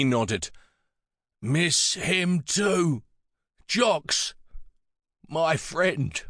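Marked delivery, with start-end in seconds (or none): 1.4-6.2 put-on high, very slow old man's voice; halting words from a frail mourner; grieving old man. A narrator's reading. Pritch missing his late friend, slow frail old voice.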